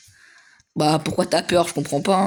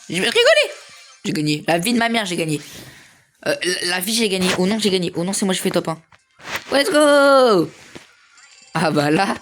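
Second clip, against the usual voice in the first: much higher and louder, thrilled at winning; victory excitement; 4 semitones higher, 4 dB louder than usual. Real, not acted. Gamer realizing he won the match, excited yelling. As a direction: shout the win in disbelief.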